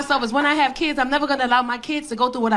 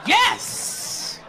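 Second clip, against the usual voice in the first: breathless whispered yes; 6 semitones higher than usual. Retold or imitated. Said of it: "She's so excited".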